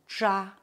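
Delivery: "Tcha" with the tongue curled back; curled-tongue sound. Shown as a demonstration. Teacher models Chinese sounds, "Tcha" with curled tongue. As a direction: say "Tcha" as a retroflex syllable. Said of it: "tourne la langue".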